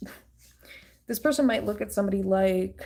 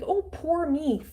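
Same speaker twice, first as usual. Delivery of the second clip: high, slow whiny poor me; mock self-pity; 9 semitones higher, slower than usual. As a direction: drag it out, pitying yourself. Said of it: "this person tries to make".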